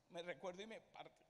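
ordinary talk, nothing marked

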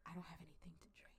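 fast, whispered line; mock whisper. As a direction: rush it out in a whisper. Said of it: "She says".

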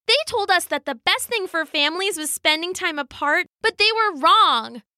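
put-on high-pitched child voice; earnest little girl. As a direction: confide as an upset child.

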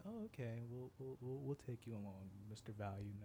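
quiet, smug employer voice; softly voiced hiring manager; soft mock boss. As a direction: hand it over like a pleased boss. Employer's reply, retold.

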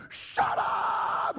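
harsh, drawn-out mock scream; hammed-up labor-pain screech. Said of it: "And she's screaming".

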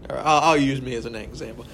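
ordinary talk

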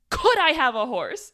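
loud, comic hit on Could; stress on Could. Retold or imitated. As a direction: hammer the word Could, imitating his funny demand. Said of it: "the emphasis on the word could".

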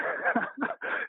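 soft, high, airy laugh; real breathless laugh. Real, not acted. Bob laughs at the host's sprint analogy, soft airy laugh. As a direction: let out a quiet laugh, barely voiced.

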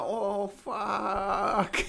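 put-on high kid's groan; a lightly voiced kid caught out; mock kid dismay. Kid on headset realising he's shot, high. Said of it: "this kid's like".

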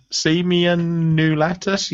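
slow, high mock reading of "Simeon Nulatus", rising; mocking questioning rise. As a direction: mimic an unsure reader, stretching "Simeon Nulatus" into a question. Co-host mocks the hesitant reading.

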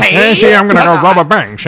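high, loud gangster caricature; hammed-up movie gangster voice; mock old-time gangster. Parodies old-time gangster bank robber.

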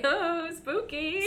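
high, drawn-out ghostly coo; mock spooky. Host teasing the figure in the woods, mock spooky. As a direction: stretch it out slowly like a silly ghost.

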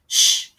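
sharp loud shush; hissed shush. A narrator's reading. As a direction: hush them with a hard hiss. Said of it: "Gretel hissed".

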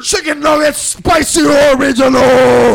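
shouting. Pretend. Shouting Wendy's menu items.

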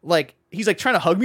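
ordinary talk, nothing marked

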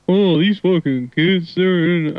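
half-hearted Southern grump imitation; Southern dude impression. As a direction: grouse like a middle-aged Southern dad. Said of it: "middle-aged Southern dude, which is, just talks like that".

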